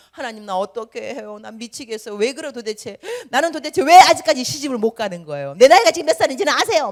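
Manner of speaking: whining prayer rising to a loud, fast complaint; whiny praying believer. Retold or imitated. Whining believer complains to God at dawn prayer, mock whine. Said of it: "기도할 때마다 징징징징".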